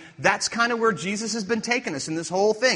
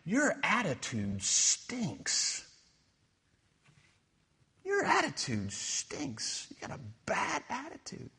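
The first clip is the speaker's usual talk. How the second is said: softer, slow, lightly voiced reproach; mock scolding; 8 dB quieter, slower than usual. Preacher imitating adults scolding him as a youth, mock scold. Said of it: "somebody would say".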